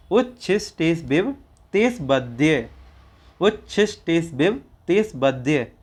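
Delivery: उच्छिस टेस बिब rising, तेस वद्य falling; phrase drill. Shown as a demonstration. Teacher drilling उच्छिस टेस बिब तेस वद्य. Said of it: "पहले बोलेंगे तेस, फिर बोलेंगे वद्य".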